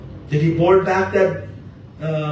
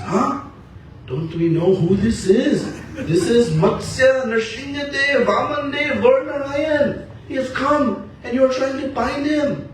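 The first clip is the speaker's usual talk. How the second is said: Balaramji amazed; 10 semitones higher than usual. Acted. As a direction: marvel at each name, much higher. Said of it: "Balaramji says".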